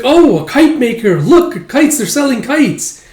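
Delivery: put-on very high, eager kid voice; child's loud, high excitement; excited child. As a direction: point out a treat like a thrilled kid. Acted child spots kite seller.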